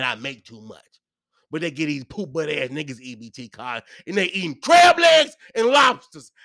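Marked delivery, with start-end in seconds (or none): 4.1-6.3 high, loud outraged yell; indignant outburst. Real, not acted. Ranting man yelling about food stamp lobster, outraged and loud. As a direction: blast it like it's the final insult.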